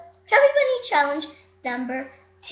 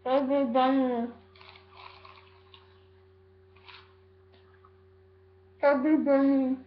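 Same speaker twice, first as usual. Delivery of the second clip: cheeks stuffed full. Real, not acted. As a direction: mouth crammed with marshmallows. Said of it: "I got four in here so far".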